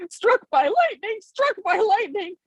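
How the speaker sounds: high, slow frightened cries; panicked calling out. A narrator's reading. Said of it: "kept on calling out".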